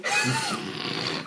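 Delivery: very high soft squeal of a laugh; shrill-pitched laughing squeak; squeal of laughter. Real, not acted. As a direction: let out a tiny high squeal.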